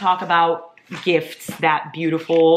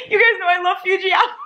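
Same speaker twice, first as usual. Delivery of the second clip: very high, laughing, teary squeal; overjoyed squeal; 13 semitones higher, 3 dB louder than usual. Real, not acted. Telling viewers she loves the gift, overjoyed squeal. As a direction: squeal it out, overcome with delight. Overcome by favourite drink gift.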